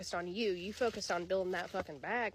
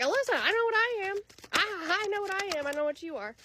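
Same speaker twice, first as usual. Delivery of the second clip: higher, louder, sassy declaration; a high, proud voice that knows its worth; sassy self-assured; 12 semitones higher, 7 dB louder than usual. Retold or imitated. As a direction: tell the reader off with full confidence. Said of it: "you may have been like".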